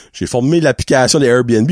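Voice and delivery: usual speaking voice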